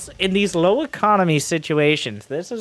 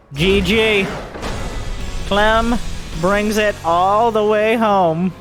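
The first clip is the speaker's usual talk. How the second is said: victory call; 4 semitones higher, slower than usual. Real, not acted. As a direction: call the winner home. Game ends, caster calls the win.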